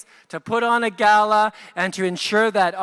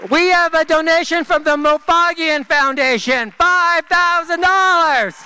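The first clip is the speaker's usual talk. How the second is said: auction caller; 5 semitones higher than usual. Acted. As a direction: announce each bidder like a gala auctioneer. Reading online donations to the room.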